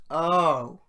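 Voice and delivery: loud, loaded "Oh" dropped like a judgement; ominous oh. Retold or imitated. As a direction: let the oh hang, heavy with disapproval. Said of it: "And he goes".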